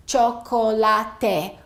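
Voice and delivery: 'chocolate' with every vowel sounded, cho-ko-la-te; every vowel pronounced. Shown as a demonstration. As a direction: say 'chocolate' slowly as cho-ko-la-te, four full syllables. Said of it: "it may sound something like this".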